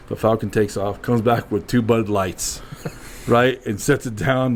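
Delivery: normal talking voice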